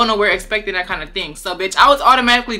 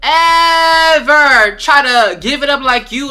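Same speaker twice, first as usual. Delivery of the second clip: loud, higher, drawn-out EVER; indignant rant; 5 semitones higher, 6 dB louder, much slower than usual. Retold or imitated. Woman telling off her cheap date, indignant. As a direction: scold loudly, stretching the words way out.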